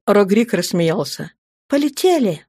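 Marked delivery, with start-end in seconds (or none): 1.7-2.4 higher, mildly sad woman's voice; a touch of sadness on a raised pitch. A narrator's reading. Этели sadly agrees to fly off, sad. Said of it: "печально сказала Этели".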